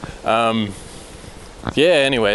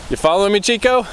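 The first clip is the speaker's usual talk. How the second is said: much higher, sing-song doggy voice; talking to a dog; 8 semitones higher, faster than usual. Real, not acted. Calling to the dog Chico on the path, high and coaxing. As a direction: coo at the dog following you.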